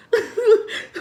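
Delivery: high loud laugh burst; loud laugh at the story's twist; burst of laughter. Real, not acted. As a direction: crack up suddenly.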